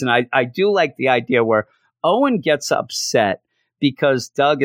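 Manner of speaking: ordinary talk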